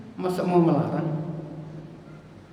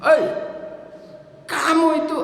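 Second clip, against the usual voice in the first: raised, higher mock scolding; sharp telling-off; 6 semitones higher, faster than usual. Parent scolds child who will not take hints, sharply. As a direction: call out hard to pull the child up. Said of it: "itu harus kita gas".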